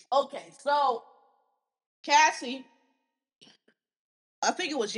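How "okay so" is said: usual speaking voice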